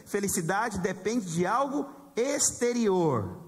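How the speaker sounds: "Felicidade depende de algo exterior" spelled out slowly, word by word; slow emphatic contrast. Shown as a demonstration. Pastor contrasting happiness and joy, slow and emphatic. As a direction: lay out "exterior" slowly for the congregation. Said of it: "Entenderam a diferença entre felicidade e alegria?".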